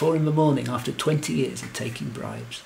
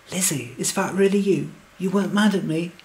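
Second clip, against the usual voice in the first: higher, eager questioning; excited; 6 semitones higher, 4 dB louder than usual. A narrator's reading. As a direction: ask it with hopeful excitement, pitch lifted. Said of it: "through my excitement".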